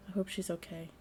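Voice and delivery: quiet, softened mumble; soft mumble. Acted. Harrison worrying about missing Stella, mumbled softly. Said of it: "drops to a barely audible mumble".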